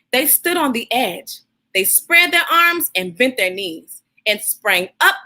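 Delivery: put-on warm, steady reading to children; story read aloud. Host reading Jabari Jumps to young viewers, gentle storytelling. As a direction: read the picture book to the kids. Reads picture book aloud.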